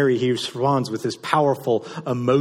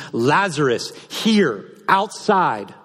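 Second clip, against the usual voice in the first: higher, louder summons to the tomb; commanding call; 5 semitones higher, 6 dB louder, much slower than usual. Acted. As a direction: call him out, word by word. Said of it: "In the Greek, it literally means".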